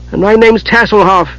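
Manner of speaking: high-voiced, mildly miffed kender; put-upon kender. A narrator's reading. Tass reminding the mage of his name, miffed. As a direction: correct them in a high little voice.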